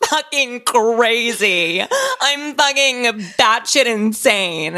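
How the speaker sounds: high, loud, slow sobbing wail; drawn-out crying shout, re-enacted; retold sobbing wail. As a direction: wail it out like a breakdown on a friend's porch. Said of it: "Did you say it, like, super loud? Oh, so loud".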